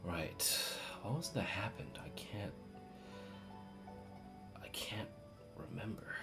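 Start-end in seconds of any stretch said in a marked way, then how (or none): none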